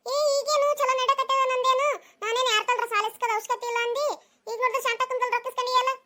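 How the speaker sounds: high-pitched mocking village gossip; teasing neighbour. Neighbour teasing about borrowed money.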